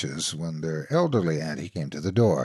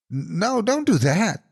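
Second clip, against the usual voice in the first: alarmed protest; 12 semitones higher, slower than usual. A narrator's reading. Little brother begging auntie not to cancel snacks. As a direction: cry out to stop it.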